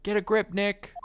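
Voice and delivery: high, peppy sidekick; cheeky young assistant; bubbly girl voice. Acted. Maya voice, high.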